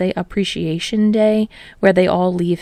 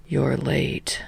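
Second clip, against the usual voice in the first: low, soft droning teacher; drone; 6 semitones lower, 8 dB quieter than usual. A narrator's reading. Tired teacher droning at late students. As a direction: drone it out, barely voiced. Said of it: "Aizawa drones".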